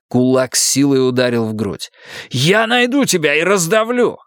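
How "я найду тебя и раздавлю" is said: high raging shout of revenge; furious threat. A narrator's reading. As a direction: threaten him with all your rage. Mario swears revenge.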